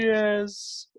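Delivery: high-pitched, drawn-out yes; mock cheer. Host acting out the reaction to cancelled exams, mock cheer. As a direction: imitate students cheering.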